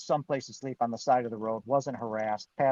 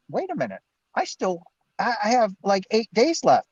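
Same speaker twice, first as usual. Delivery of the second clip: protest; 7 semitones higher, 6 dB louder than usual. Retold or imitated. Protesting eviction to the office.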